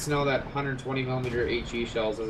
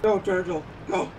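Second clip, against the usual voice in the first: loud eager cheer; 6 semitones higher, 4 dB louder than usual. Real, not acted. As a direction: urge him on up the hill. Cheering a teammate on.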